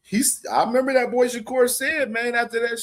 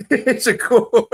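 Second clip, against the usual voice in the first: louder, laughing out the name; 3 semitones higher, 7 dB louder than usual. Real, not acted. Streamer cracking up at the boxer's name, laughing. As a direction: blurt the name mid-laugh.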